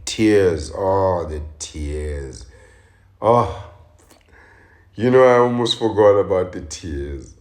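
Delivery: sarcastic, loud exaggerated wailing over the tears; mocking the mogul's tears with overblown grief; mock lament. Real, not acted. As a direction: ham up the sorrow mockingly. Mocking his courtroom tears.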